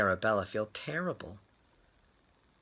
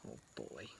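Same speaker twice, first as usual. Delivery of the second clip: put-on oh boy aside; 12 semitones lower, 24 dB quieter than usual. Aside after the dizzy spell.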